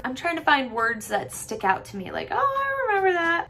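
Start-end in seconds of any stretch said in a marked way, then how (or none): 2.3-3.5 delighted recognition. Acted. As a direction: act out a sudden happy recognition, high up. Acts out sudden recognition.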